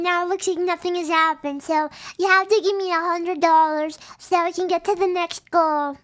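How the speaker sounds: normal talking voice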